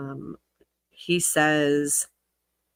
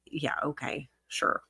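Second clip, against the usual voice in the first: sarcastic whisper; 3 semitones lower, 8 dB quieter than usual. Real, not acted. Sarcastic aside while reading.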